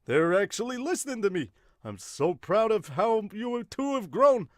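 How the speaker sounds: high-pitched, gushing teacher voice; proud teacher. Acted. Teacher proud of two listening students, high gushing voice. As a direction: gush high, drawing out the praise. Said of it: "I love the teacher here".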